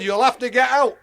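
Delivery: high, loud order to leave. Retold or imitated. Zoo keeper orders him out, high and loud.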